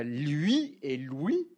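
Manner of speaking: "lui et lui" slowly, forcing the two vowels apart; forced contrast. Shown as a demonstration. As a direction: stretch out "lui et lui" to mark the vowel difference. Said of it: "vous entendez que j'essaye, je force".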